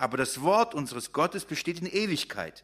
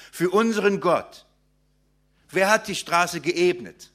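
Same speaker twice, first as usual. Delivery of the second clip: raised, ringing sermon voice, proclaiming each line; preaching; 4 semitones higher, 5 dB louder than usual. Acted. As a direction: proclaim it to the congregation with rising fervour. Reads and proclaims verse three.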